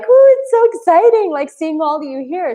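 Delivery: high, loud gushing Ooh; excited gush. Retold or imitated. Greeting her live viewers, gushing with mock excitement. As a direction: squeal it out like seeing old friends. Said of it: "And so I'm like".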